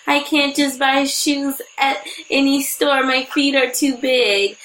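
high, slow whiny crying voice; imitating a friend's teary complaint; mock crying friend. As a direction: whimper it like a big baby. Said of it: "he started crying".

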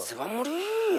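mock alarm. Teller mocks the warning that his friend will die, high and slow. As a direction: stretch it out high like a panicked warning.

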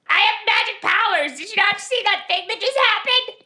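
high, indignant put-on voice; boastful impostor. Polymorphed impostor convincing the guards, high boastful voice.

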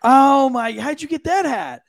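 high, loud, slowed disbelief; amazed. Real, not acted. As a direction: marvel at it out loud. Amazed at the hat.

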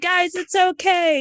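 calm the room down gently; soothing. Retold or imitated. Locker room leader reassuring upset colleagues, soothing. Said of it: "And I'd be like".

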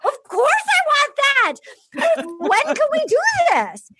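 high, excited mock gush; eager Claire. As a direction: squeal it like she can't wait. Claire voiced eager for proposal.

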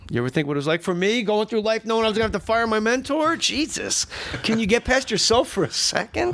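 high, exasperated outburst at a mentor; acted film scene. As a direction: act it out high and indignant. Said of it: "I'll do the whole scene with you".

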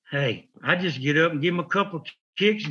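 usual speaking voice

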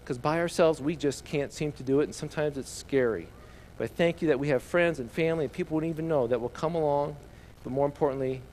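soft, reverent prayer voice. Real, not acted. Leads the children in prayer.

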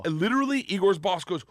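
usual speaking voice

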